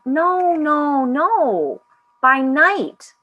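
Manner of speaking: high, drawn-out no-no-no; emphatic correction. A narrator's reading. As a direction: correct them insistently, loud and high. Voice corrects the actor.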